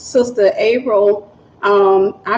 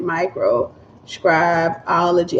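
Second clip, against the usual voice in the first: "Microscribology" slowly, piece by piece, as if typing it; low, slow "micro... scrib... ology"; sounding it out; 4 semitones lower, 4 dB quieter, much slower than usual. Real, not acted. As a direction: break "Microscribology" into drawn-out parts, half to oneself. Sounds out word while typing.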